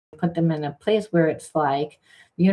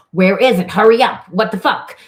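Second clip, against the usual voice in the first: loud, higher, exasperated demand; impatient; 5 semitones higher, 8 dB louder than usual. Retold or imitated. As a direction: push them to hurry, fed up. Impatient partner voiced in quarrel.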